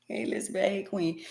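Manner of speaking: ordinary talk, nothing marked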